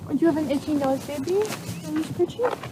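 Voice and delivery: high, soft cooing to a pet. Real, not acted. Baby talk to itchy horse.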